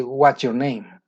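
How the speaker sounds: "What's your name?" with the pitch dropping on "name"; matter-of-fact question, "name" going down; falling intonation. Shown as a demonstration. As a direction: let "name" fall at the end, English style. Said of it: "la entonación es foreign intonation".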